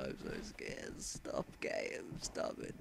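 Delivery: whispered, frightened plea; timid whisper; scared whisper. Real, not acted. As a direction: hush it, begging the game. Scared of game.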